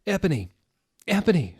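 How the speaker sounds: high, loud calling after her; mock sad shout. Draco calling after Ebony, loud mock-sad cry. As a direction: call out dramatically, hammed-up. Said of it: "shouted Draco sadly".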